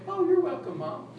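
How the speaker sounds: high, innocent sing-song; hammed-up sweet kid taking credit; smug teen. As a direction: accept the thanks with mock innocence. Teen smugly accepting credit.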